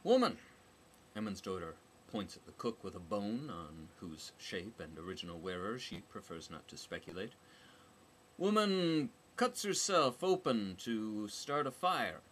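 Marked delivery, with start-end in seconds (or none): 0.0-0.4 loud, high shout of woman; sharp yell for attention; calling out. A narrator's reading. Calling out at cook. 8.4-12.2 slow, loud, higher blunt report; slow incredulous statement. A narrator's reading.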